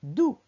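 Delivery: "dou" cut short, not held; short vowel. Shown as a demonstration. Teacher dictating a syllable to write, "dou" kept short. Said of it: "Je n'ai pas dit dou".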